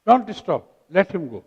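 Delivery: usual speaking voice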